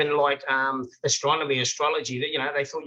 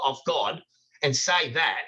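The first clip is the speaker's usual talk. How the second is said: usual speaking voice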